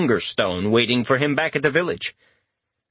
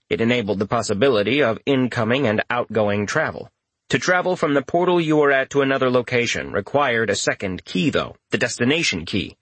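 plain reading voice

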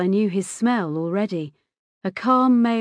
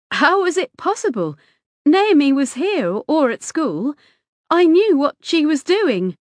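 high, louder, insistent disbelief; incredulous protest; 8 semitones higher, 6 dB louder than usual. A narrator's reading. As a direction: challenge the boy in disbelief. Mother refuses to believe the boy.

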